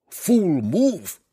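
high, loud dwarf crowing in triumph; dwarf gloating as the monster hesitates; gleeful cry. Acted. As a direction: crow it out with glee. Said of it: "the dwarf cried in glee".

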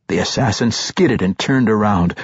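ordinary delivery, nothing marked

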